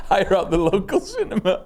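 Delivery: high laugh running into the words; laughing while telling it. Real, not acted. Laughing at own story.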